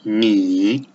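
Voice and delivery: "Ni" low and dipping, Mandarin third tone. Shown as a demonstration. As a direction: sink "Ni" low then lift it. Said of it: "like this".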